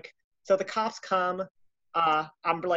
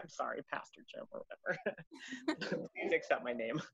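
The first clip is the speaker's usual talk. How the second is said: mock sheepish apology; 11 dB quieter than usual. Apologizing to absent pastor.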